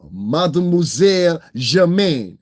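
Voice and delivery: slow, drawn-out fake French accent on Germaine; hammed-up French flourish; mock French. Mocking a rival host with a slow fake French title.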